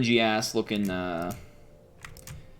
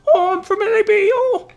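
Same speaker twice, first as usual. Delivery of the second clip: very high, loud falsetto; copying Jamie's teasing of LAB kids; mock squeaky kid; 9 dB louder than usual. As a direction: squeak it like a cartoon child. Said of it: "He'd be like".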